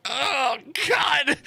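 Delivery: high, shouted mock despair; mock anguished cry. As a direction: wail it like a kid losing his stash. Retold friend's horror at cop.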